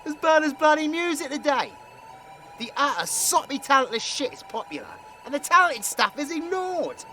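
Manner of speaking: high, loud griping; exasperated rant. Acted. Grumbling about talentless music getting popular, exasperated. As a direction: complain loudly, pitch riding up in indignation.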